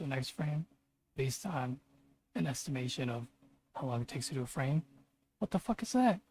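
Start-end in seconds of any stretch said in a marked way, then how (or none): 5.4-6.2 higher, sudden what-the; real startled frustration; exasperated outburst. Real, not acted. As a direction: blurt it out in disbelief.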